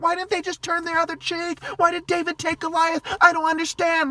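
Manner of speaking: high, loud mocking whine; mocking whiny churchgoer. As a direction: sneer it as a whiny pious type. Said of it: "like a bitch".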